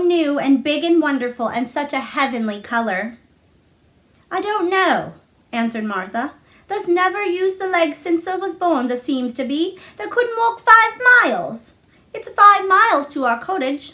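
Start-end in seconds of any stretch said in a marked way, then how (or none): 4.4-13.8 a cheerful Yorkshire maid, higher; bright housemaid voice, a bit higher; Martha, lightly voiced. Martha answering.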